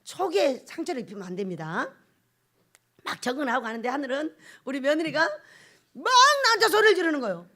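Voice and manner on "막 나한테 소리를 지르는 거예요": ordinary talk, nothing marked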